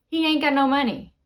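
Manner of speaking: said fast, words run together; quick repeat. Shown as a demonstration. Teacher repeating a slang example to learners, quick and run together. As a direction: rattle off "He ain't got no money" in one go.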